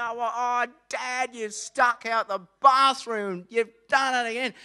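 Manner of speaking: loud, slow, hammed-up retching cry; disgusted shout. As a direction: recoil from the stench out loud. Bursting into the smelly bathroom.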